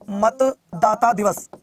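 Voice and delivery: very slow, word by word; slow emphatic repetition. Real, not acted. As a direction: stretch out मतदाता, then दिवस, as if for dictation. Teacher repeats term slowly, word by word.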